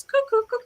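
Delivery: high mock cricket chirping; playful cricket noise imitation; cricket chirps. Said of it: "it's just cricket chirps".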